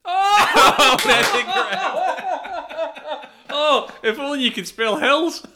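high, loud cackling through the words; gleeful mocking laughter. Real, not acted. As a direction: crow over a rival's blunder, laughing. Gleefully mocks rival's spelling mistake.

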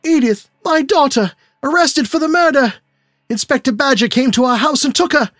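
father frantic over his arrested daughter; frantic despair. A narrator's reading. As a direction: cry out as if losing your mind. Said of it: "burst wildly into the room".

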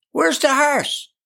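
high, drawn-out yell without much added volume; sudden yell. A narrator's reading. Eli surveying the scene, high sudden yell. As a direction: call out high and slow. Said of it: "he yelled suddenly".

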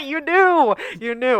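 laughing through the words; words broken by laughter. Real, not acted. Laughing at the cohost.

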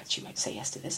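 whispered, low and very soft; hushed aside of self-doubt; doubtful private thought. Retold or imitated. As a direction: whisper it to yourself, expecting refusal.

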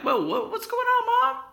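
acted alarm in a very high voice; startled. Son to his screaming mom, startled. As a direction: squeal it like a bewildered son.

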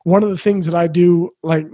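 ordinary talk, nothing marked

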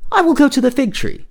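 high-pitched self-talk; decide aloud, voice lifted high; resolving young brother. Acted.

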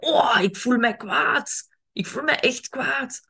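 slow, high and calm; calmly naming anger. Shown as a demonstration. Showing how to tell a friend you are angry, slow and calm. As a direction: state your anger gently, unhurried. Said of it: "om te zeggen".

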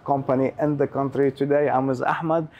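loud, upbeat host opening to camera; show host intro. Acted. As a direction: open the show like a presenter plugging the sponsor. Host's show opening and sponsor read.

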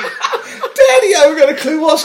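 very high, loud mock wail to Daddy; hammed-up clueless kid, laughing; mimicked video cry. Mate joking along with the bat video, high mock-kid yell. Quoting the clip while it plays.

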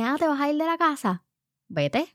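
unbothered mother's challenge; calling the bluff. Retold or imitated. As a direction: feign interest, then dismiss coolly. Mother calls daughter's bluff.

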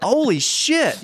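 high, drawn-out shock; mock stunned exclamation; retold freak-out. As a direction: stretch it out high, reenacting alarm. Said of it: "I was freaking out".

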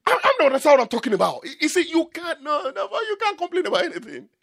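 high-pitched, agitated sermon delivery; worked-up preaching. Acted. Preacher telling listeners they can't complain, worked-up preaching.

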